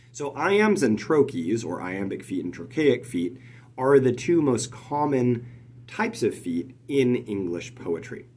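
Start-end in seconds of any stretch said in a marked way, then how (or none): none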